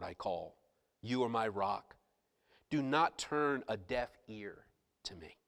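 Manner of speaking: hushed, low, reverent scripture reading; soft, low, solemn delivery; solemn preaching. Real, not acted. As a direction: drop the voice and read it gravely. Preacher turns to a psalm.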